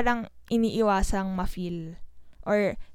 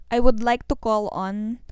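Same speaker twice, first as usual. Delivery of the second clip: louder, hammed-up announcer call; mock announcer; 8 dB louder than usual. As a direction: play it like a formal summons over a mic. Mock formal call to yellow lady.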